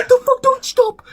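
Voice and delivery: very high shocked outcry; shrieking dismay. Real, not acted. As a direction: screech in horror. Horrified at bacon talk.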